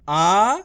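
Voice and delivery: आ held long and loud; stretched vowel. Shown as a demonstration. Teacher sounding out the spelling, आ stretched long. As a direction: draw आ out as one sustained vowel. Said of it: "आ का उच्चारण खेच को हो रहा है".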